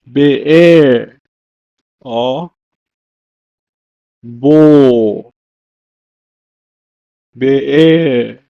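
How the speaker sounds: be'er and bo drawn out and falling; Hebrew pronunciation. Shown as a demonstration. Teacher gives both Hebrew words for well, long be'er and bo. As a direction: model "be'er" or "bo" with long vowels. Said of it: "it's pronounced as".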